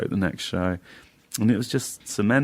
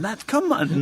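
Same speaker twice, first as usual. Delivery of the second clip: sudden high mock outrage; mock outburst; 14 semitones higher, slower than usual. Comic partner going mental at a polystyrene wife, mock outrage. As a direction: blow up at a tiny cup piece, hammed-up. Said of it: "he went mental and went".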